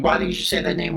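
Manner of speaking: playing Batman still yelling; mock yell. Reviewer imitating Batman still yelling, mock shout. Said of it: "he's still yelling".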